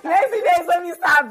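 bursting into high laughter while talking; laughing at her own slip, catching herself. Real, not acted. Guest laughing at her own marriage joke, laughing high and loud. Joking about marrying soon.

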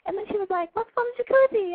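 put-on high, sweet little-boy voice; cute kid voice. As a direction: play an innocent young boy telling his story. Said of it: "It's way cuter when you're like".

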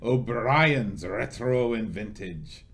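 slow, hammed-up mock Irish leprechaun voice; Lucky Charms guy. Host reading a sponsor's name in a mock Irish mascot voice. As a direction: drawl it like a cartoon Irish leprechaun. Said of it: "That's my Lucky Charms guy voice".

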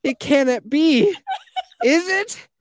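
loud, high mock-tragic wonder; melodramatic. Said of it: "It's so dramatic".

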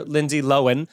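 "Lohan" said as LO-en; corrected pronunciation. Shown as a demonstration. Refusing the new name, "Lohan" as LO-en. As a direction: say "Lohan" without the h, rhyming with Owen. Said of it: "I am not going to call her that".

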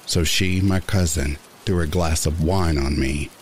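ordinary delivery, nothing marked

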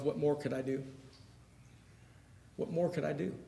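quietly wondering, as if to himself. Acted. Jesus asking himself after rejection, quiet and inward. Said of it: "says to himself".

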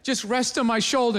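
ordinary talk

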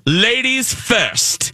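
high, drawn-out haughty rebuke; sassy Cruella de Vil. Retold or imitated. Villainess cuts off the man introducing himself, sassy. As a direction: slowly put him in his place. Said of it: "she was so sassy".

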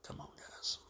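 ordinary talk

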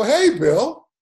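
high, loud questioning hello; retold puzzled phone greeting; hesitant greeting. Player answering a mystery caller, puzzled greeting. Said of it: "And I go".

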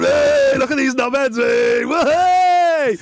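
high, loud whooping shouts; shouting at fight. Retold or imitated. Fan in the car park cheering on two drunks, shouting loud. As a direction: egg on the brawling drunks, yelling. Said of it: "You're straight in the car park going".

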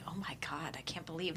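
whispered amazement; breathy hushed quote. Retold or imitated. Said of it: "that he was like".

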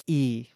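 E as a long "ee" sound; vowel demonstration. Shown as a demonstration. Co-host explaining how i's are pronounced, E demonstrated. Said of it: "Eyes are usually like E".

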